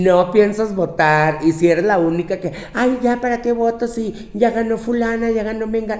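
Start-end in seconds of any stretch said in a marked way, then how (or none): 2.7-6.0 mock whiny excuse. Mocking lazy non-voter.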